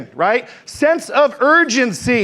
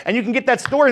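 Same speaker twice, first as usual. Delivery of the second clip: usual speaking voice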